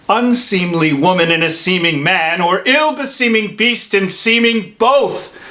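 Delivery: high-pitched, indignant scolding; stern rebuke. Acted. Friar shaming a weeping, desperate man, indignant rebuke. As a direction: berate him, quickening as the reproaches pile up.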